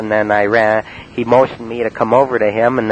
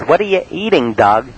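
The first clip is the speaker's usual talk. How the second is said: mock high voice; 5 semitones higher than usual. Rick's quote, high pitch.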